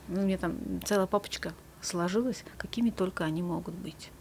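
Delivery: whispered, trailing-off aside. Real, not acted. Therapist whispers an aside about her folder of covid-era fears. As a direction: drop to a confiding whisper.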